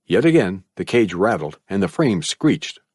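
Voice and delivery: ordinary delivery, nothing marked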